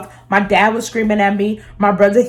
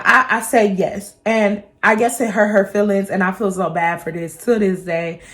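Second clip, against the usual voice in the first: ordinary talk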